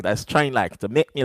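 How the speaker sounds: ordinary talk, nothing marked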